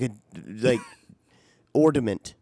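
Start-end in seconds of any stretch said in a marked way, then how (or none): 1.7-2.3 "ornament" pronounced "ordament"; "ornament" as or-duh-ment; D in the middle. Retold or imitated. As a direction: imitate the D in "ornament" replacing the N. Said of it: "puts a D in the middle".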